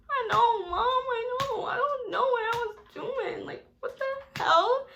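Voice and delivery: high, whiny, half-crying I know Mom; mock whine. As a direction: whimper it like a sorry kid. Whining to mom about mistake.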